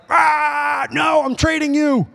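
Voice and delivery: high, exploding mock scream; emotional explosion. Mom who kept taking the hit, exploding at her daughter. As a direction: burst out shouting like a parent who snaps. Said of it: "This, like, explosion of emotion".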